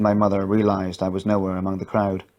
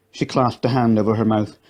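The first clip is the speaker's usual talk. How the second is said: usual speaking voice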